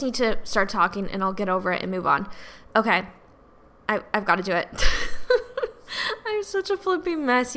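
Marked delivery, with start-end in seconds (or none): 4.7-6.2 long high airy laugh at yourself; self-mocking laugh. Real, not acted. Host laughing at herself for being a mess, high airy laugh. 6.3-7.6 higher, laughing through the words. Real, not acted. Host telling listeners she's a mess, laughing through it.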